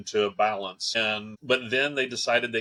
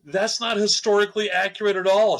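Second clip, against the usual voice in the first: raised, louder mock protest; indignant objection; 8 semitones higher, 5 dB louder than usual. Calling out mother-in-law's double standard, indignant retold protest. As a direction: retell it as a high, loud objection.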